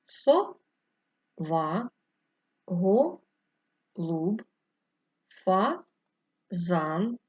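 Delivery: slow, drawn-out dictation of each word; dictation pace. Shown as a demonstration. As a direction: read each word slowly for pupils to write. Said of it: "поділяючи їх рискою для переносу".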